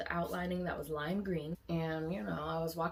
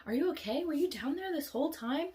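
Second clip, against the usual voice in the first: anxious news anchor; 11 semitones higher than usual. Retold or imitated. News anchor lady rushes to the girl, high and fussing. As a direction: gush over the kid like a frantic reporter. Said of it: "news anchor lady runs over to me".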